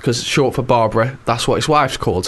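a hint of a tired husband back home; ticket man to his wife. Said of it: "said to his wife".

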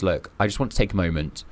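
ordinary delivery

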